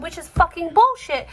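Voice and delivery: high, indignant retold outburst; indignant quote of your own protest; retold anger. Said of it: "I was getting angry".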